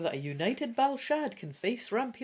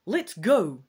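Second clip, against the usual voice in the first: loud sudden call to go; sudden cry; 10 dB louder than usual. Acted. Dragon urging the group to leave, loud cry. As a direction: blurt it out urgently. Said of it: "you cry suddenly".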